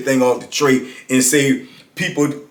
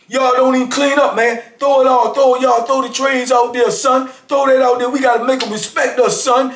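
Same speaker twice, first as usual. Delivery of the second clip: higher, shouted rant about cleaning up; mock hollering; 7 semitones higher than usual. Inmate yelling at the block not to clean, mock shout. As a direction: egg the block on, hammed up. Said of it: "And he said".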